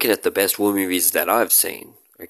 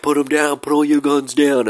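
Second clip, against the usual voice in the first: mock soldier yell; 7 semitones higher, 4 dB louder than usual. American troops yelling at Germans.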